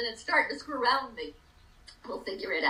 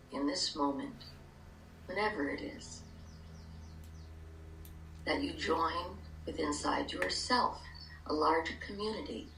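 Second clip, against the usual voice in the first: calm guided meditation, hushed; 3 semitones lower, 4 dB quieter than usual. Acted.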